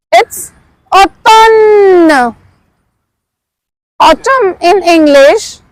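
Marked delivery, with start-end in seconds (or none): 0.9-2.3 "automne" drawn out slowly; slow model. Shown as a demonstration. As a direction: hold the end of "automne" for learners. Teacher models French word slowly.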